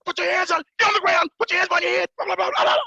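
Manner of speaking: imitated officer yelling, high and loud; shouted police orders. Officer ordering a man onto the ground, shouting. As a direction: fire off commands in a high, loud mock shout.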